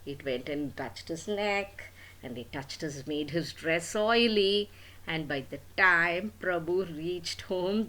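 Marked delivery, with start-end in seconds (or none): none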